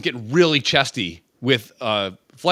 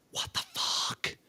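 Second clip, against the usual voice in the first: breathless whispered aside; hushed, stunned whisper; 14 dB quieter, slower than usual. Real, not acted. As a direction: whisper it in disbelief. Awed aside at spinning solar system.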